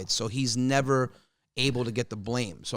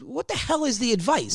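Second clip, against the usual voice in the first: higher, pitched-up disbelief; exasperated outburst; 11 semitones higher than usual. Retold or imitated. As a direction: push it up in frustration. Exasperated at father.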